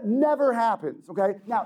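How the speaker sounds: usual speaking voice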